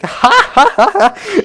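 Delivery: high, loud imitation of a stoned giggle; mock stoned giggle. Mimicking the high coworker laughing at nothing, high mock giggle. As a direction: cackle like a coworker who is high.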